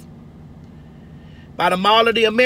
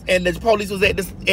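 ordinary talk, nothing marked

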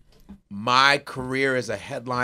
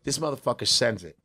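usual speaking voice

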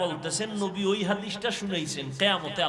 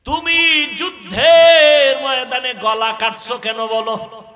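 high, booming, drawn-out sermon cry; full-force preaching; 6 semitones higher, 14 dB louder than usual. Acted. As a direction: thunder God's question at the martyr, stretching key words. Preaches, voicing God's questioning.

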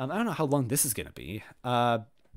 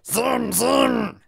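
high-pitched nonsense grumble; put-on voice repeating its noise, pitched up; grumbling creature; 10 semitones higher, 6 dB louder, much slower than usual. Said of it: "he grumbled".

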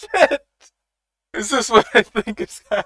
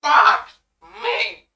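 sudden loud curse, jumping up in pitch; a loud stunned outburst at the screen; shocked shout; 10 semitones higher, 7 dB louder than usual. Real, not acted. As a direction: blurt it out in real shock. Shocked reaction to the episode.

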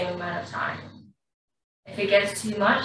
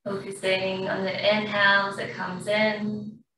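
guided breathing. Acted. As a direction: ease the class through each breath. Yoga teacher guides breathing.

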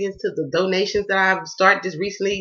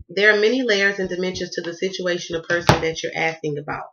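ordinary talk

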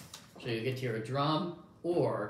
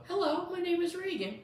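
put-on very high little-kid voice. Shown as a demonstration. Showing the listener how the child sounds, high child voice. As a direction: pitch it right up like a small child. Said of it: "when you hear a little kid go".

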